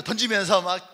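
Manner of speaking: ordinary delivery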